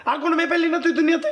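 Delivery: high-pitched, incredulous rant; worked-up, exasperated challenge; exasperated scolding. Acted. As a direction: scold your brother, pitch up. Elder brother scolds brother over marriage.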